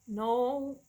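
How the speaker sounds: ordinary talk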